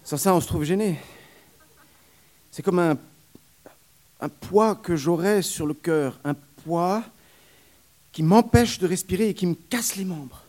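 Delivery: a weary wet nurse pouring out her trouble; nurse character voice. Acted.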